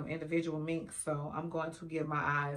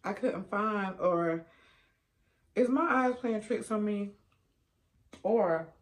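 talking between bites; chewing while talking; 4 semitones higher, 7 dB louder, slower than usual. Real, not acted. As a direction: mouth partly full. Seen 4: Fork at lips, eating salad bites while talking throughout.

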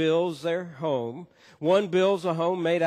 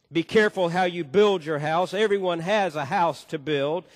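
louder, emphatic preaching of a saying; preaching quote; 4 semitones higher, 5 dB louder than usual. Acted. Pastor quoting a saying to congregation, emphatic preaching. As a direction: press the saying home to the flock.

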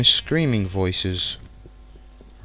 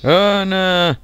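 high loud cry of alarm; 11 semitones higher, 9 dB louder than usual. Real, not acted. Gamer facing sudden danger, loud alarmed cry.

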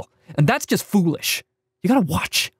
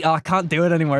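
normal talking voice

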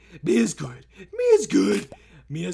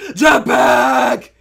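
thrilled shout; 8 dB louder, much slower than usual. Pretend. Player grabs the jetpack, thrilled shout. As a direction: shout it out and hold the cheer.

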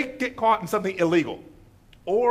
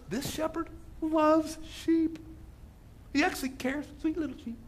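mock sweetness; 8 semitones higher, 5 dB quieter than usual. Pharisees scoffing at a sheep-loving shepherd, mock sweetness. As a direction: coo over it with mock tenderness. Mocks the tender shepherd, high.

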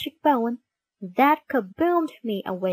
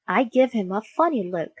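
ordinary delivery, nothing marked

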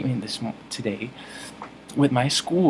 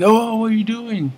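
high, loud imitation of alarmed onlookers; hammed-up, high-pitched protest; mock shocked outcry; 10 semitones higher, 6 dB louder than usual. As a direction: cry out like a startled crowd. Acts out shocked bus passengers.

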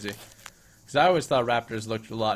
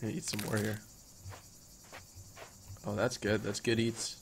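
quiet savoring; 3 semitones lower, 11 dB quieter than usual. Real, not acted.

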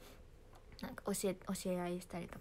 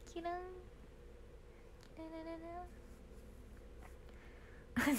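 sparkle sound; 8 semitones higher, 9 dB quieter, faster than usual. Acted. Idol sparkle sound effect.

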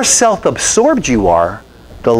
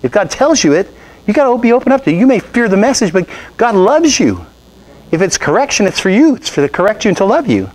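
much higher, insistent preacher pleading; retold exhortation; 8 semitones higher than usual. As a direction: press the truth on her, pitch up high. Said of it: "I said".

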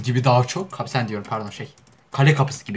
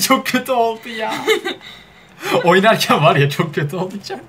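high-pitched, laughing through the words; 9 semitones higher than usual. Real, not acted. Laughing at how bad the translation turned out. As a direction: barely get it out for laughing.

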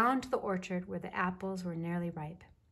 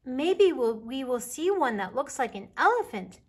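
put-on louder, higher, swooping child excitement; excited; 6 semitones higher, 8 dB louder than usual. A narrator's reading. Baby Bear imagines cloud shapes with Mama, excited. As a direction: burst out like an excited little cub. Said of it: "Baby Bear said excitedly".

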